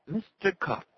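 very high, strained doctor voice; thin, unnatural voice. A narrator's reading. Doctor addresses Arnie by phone, thin unnatural voice. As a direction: make it thin, high and artificial. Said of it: "in a thin, unnatural voice".

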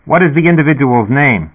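a dispatcher crackling through the speaker; sputtering intercom voice. A narrator's reading. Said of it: "the speaker sputtered".

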